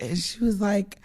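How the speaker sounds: ordinary talk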